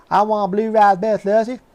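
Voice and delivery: high, loud imitation of a Southern accent; loud, high mock Southern accent ordering at the register. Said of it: "he had this really strong Southern accent".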